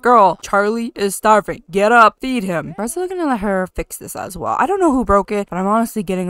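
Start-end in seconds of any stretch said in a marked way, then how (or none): 0.0-2.7 louder nagging call to a sleeping daughter; mock wake-up yell. Mom yelling at sleeping daughter to get up. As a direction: yell at her to get up and feed the baby. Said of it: "I'm like".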